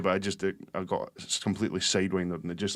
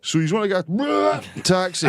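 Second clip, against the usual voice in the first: put-on slurred, higher drunk Scottish voice; drunk mate voice; 9 semitones higher, 4 dB louder than usual. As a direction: do your drunk mate asking for a taxi. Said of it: "he goes".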